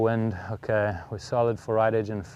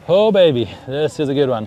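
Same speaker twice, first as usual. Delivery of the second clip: excited; 4 semitones higher, 9 dB louder than usual. Real, not acted. Excited reaction to his own shot.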